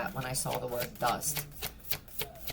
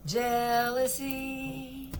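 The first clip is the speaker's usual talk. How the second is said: Jealousy stretched very slowly, higher; drawn-out Jealousy; 8 semitones higher, much slower than usual. Shown as a demonstration. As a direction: linger on every sound of Jealousy. Drawn-out repeat of the word.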